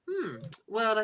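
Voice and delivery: lightly voiced stuck-up lady; snooty posh lady. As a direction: look down your nose at them. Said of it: "Oh, I like that".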